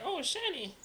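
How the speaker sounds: high dazzled voice. Retold or imitated. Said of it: "and it's like".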